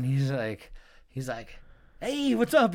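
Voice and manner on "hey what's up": high, loud, friendly hail; upbeat greeting. Retold or imitated. Traveler greets a fellow passenger at the airport, upbeat. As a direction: imitate a chummy stranger calling hello.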